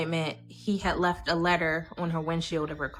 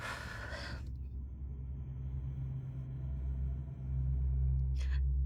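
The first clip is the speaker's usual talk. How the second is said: shaky sigh; 10 dB quieter than usual. Real, not acted. Breaks off recounting mother's shooting.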